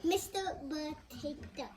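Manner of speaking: "Mister Potato" beat by beat; broken into syllables. Shown as a demonstration. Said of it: "Four syllables".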